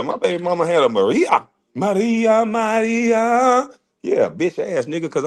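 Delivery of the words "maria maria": high sing-song call of a name. Pretend. Baby mama calling for her maid Maria, high sing-song call. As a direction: call the maid from across the house.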